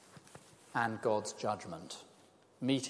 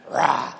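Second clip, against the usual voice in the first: a much louder child's pretend roar; boy roaring at the speaker like a lion, louder this time; mock lion roar; 3 semitones higher, 12 dB louder than usual. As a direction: roar at him the way the boy did. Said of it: "he came up to me and went".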